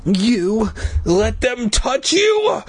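slow, rising incredulous question; disgusted demand. A narrator's reading. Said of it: "Doctor Gray demanded, twisting his face in disgust".

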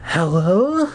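put-on high, small girlish hello; a sick girl's feeble hello; faint little girl voice. Sick girl answering the phone, faint and high. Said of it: "in a faint little girl voice".

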